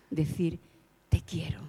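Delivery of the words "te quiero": breathy whisper of "Te quiero", no voice; whispered love. Retold or imitated. Preacher voicing God's words to the man, whispered.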